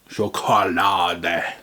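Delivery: "Schokolade" slow, in a stern German accent, ending in -deh; mock angry German. Shown as a demonstration. Mocking the German label as angry, slow stern German accent. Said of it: "You don't have to be so angry about it, Germans".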